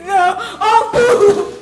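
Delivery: desperate sobbing shouts; shouting. Acted. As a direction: wail at him through tears. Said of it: "I'm not shouting again".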